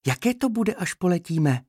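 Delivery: put-on high, eager child-bee voice; curious little bee. A narrator's reading. Little bee asks the trainer about flying, curious and high. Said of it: "zeptala se zvědavě jedna malá včelka".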